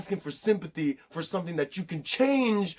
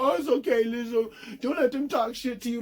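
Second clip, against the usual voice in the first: high-pitched mock reassurance; consoling fans; 8 semitones higher than usual. Fans comforting [name] over hateful comments, mock consoling. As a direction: coo it high like a fussing supporter.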